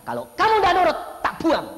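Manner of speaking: high, loud angry threat; father's threat. Retold or imitated. Father threatening to throw his son out, loud and angry. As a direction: snap out the ultimatum.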